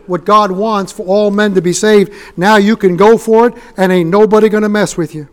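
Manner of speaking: loud, ringing revival preaching; loud fervent sermon climax; full-force preaching. Acted. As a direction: preach it out to the whole room.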